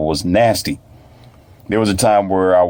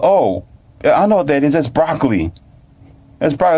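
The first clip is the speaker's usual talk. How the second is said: put-on higher-pitched child recognising a food; imitated knowing child; 7 semitones higher than usual. Child shown broccoli by mentor, imitated recognition. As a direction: play a kid who knows the answer.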